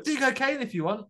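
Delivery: high, sarcastic mock-permissive dad. Dad mocking his own rule, in a high sarcastic voice. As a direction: play the dad who allows anything but the sea. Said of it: "I'm like".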